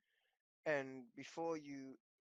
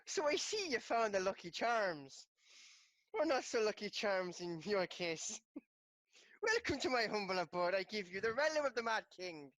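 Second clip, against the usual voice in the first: put-on loud, high Irish-accented gnome; 5 semitones higher, 6 dB louder than usual. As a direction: proclaim it like a gleeful Irish gnome. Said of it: "he's Irish, actually".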